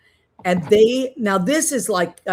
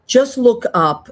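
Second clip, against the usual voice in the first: slow, loud, higher push on look up; emphatic urging; 6 semitones higher, 8 dB louder, slower than usual. Real, not acted. Urging listeners to visit.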